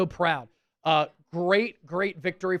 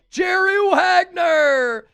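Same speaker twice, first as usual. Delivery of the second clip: high, loud, very slow stretched name; excited shout; 12 semitones higher, 9 dB louder, much slower than usual. Real, not acted. Host announcing the top bidder, shouting. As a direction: belt out the winning bidder's name. Rival outbids for helmet.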